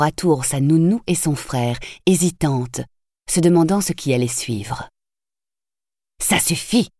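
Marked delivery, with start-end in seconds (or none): 6.2-6.9 mildly stern, a bit higher; understated sternness. A narrator's reading. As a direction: scold the boy briefly. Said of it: "d'un ton sévère".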